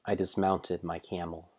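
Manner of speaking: plain reading voice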